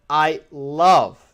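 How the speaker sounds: LOVE drawn out slowly, set apart after "I"; slow, weighty emphasis on "love"; stress on LOVE. Real, not acted. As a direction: lean hard and slow on the word "love". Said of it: "emphasis on the word love".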